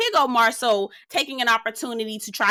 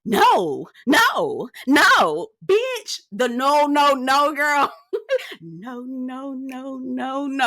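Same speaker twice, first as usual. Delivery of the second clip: disbelieving no; 3 semitones higher, much slower than usual. Real, not acted. Reacting in disbelief to his line.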